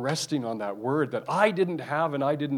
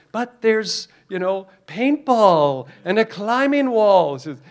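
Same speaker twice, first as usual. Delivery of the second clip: high, louder pleading Smeagol voice; voicing Smeagol; 9 semitones higher, 8 dB louder, slower than usual. Smeagol pleading with the demon to go, high and whiny. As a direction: whine it like a hopeful Smeagol. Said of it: "then Smeagol says".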